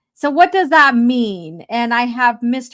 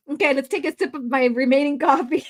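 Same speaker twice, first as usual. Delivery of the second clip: usual speaking voice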